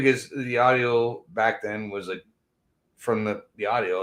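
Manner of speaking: normal talking voice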